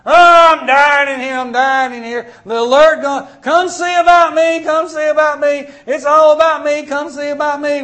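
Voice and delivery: high, loud mock baby wail. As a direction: voice a screaming baby demanding attention. Pastor voices a screaming baby.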